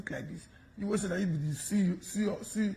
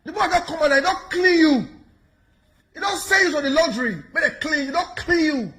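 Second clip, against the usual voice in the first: high, loud gloating taunt; 8 semitones higher, 7 dB louder than usual. Real, not acted. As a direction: crow it loudly at your rival. Loudly taunting the man exposed.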